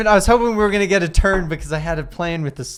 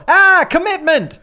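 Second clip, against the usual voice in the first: high, loud hammed-up cry of fear; mock cry; 6 dB louder, slower than usual. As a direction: shriek it out in mock terror, slowly. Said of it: "I go".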